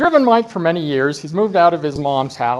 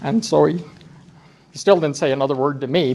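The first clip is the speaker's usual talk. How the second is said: normal talking voice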